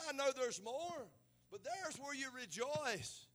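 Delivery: loud, high, fervent sermon voice; full-force preaching. Acted. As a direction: preach it hard to the congregation.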